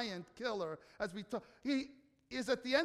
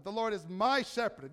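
"my" punched louder than the words around it; stress on "my"; 4 dB louder than usual. Shown as a demonstration. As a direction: lean hard on the word "my". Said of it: "I want you to emphasize the word my".

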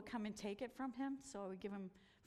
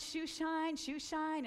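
put-on street boys calling; 6 semitones higher, 4 dB louder, much slower than usual.

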